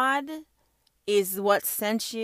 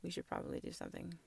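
whispered aside; 2 semitones lower, 14 dB quieter, much faster than usual. Real, not acted. Repeated line, hushed aside.

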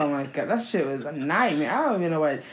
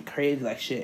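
ordinary talk

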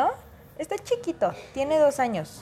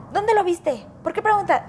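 high, tense, suspicious questions; alarmed reaction; 8 semitones higher than usual. Retold or imitated. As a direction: fire it back as an alarmed parent. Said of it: "la reacción automática es". (padre que reacciona alarmado ante la pregunta del niño, agudo y tenso)

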